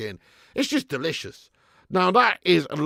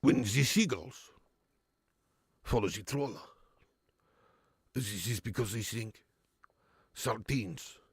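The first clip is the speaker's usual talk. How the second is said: Cantona impression; 6 semitones lower, 8 dB quieter than usual. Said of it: "Eric Cantona impression".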